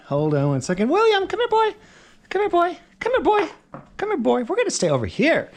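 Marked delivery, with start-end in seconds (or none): 0.9-4.4 much higher sing-song call to a dog. Real, not acted. Calling his dog over. 4.4-5.5 higher coaxing dog-talk; gently steer the puppy, voice raised. Real, not acted. Still talking to the dog.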